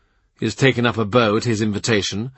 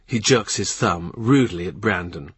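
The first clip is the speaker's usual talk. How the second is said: plain reading voice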